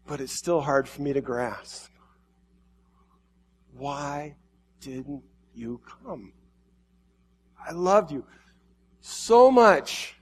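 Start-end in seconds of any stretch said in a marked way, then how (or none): 3.7-8.3 much softer, slow, broken question; hushed grief. Acted. Jesus grieving over the lost, hushed and slow. 9.0-10.2 higher and louder, crying out in anguish. Acted. Jesus crying out his love, high and loud. Jesus grieving over the lost.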